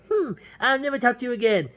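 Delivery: high, put-on petulant voice; mock huff. James walks out on the girl, mock huff. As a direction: huff it like a spurned lover.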